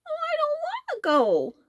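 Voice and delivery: very high whiny plea; whining elephant. A narrator's reading. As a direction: whine like a sulky child. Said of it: "whined the tremendous creature".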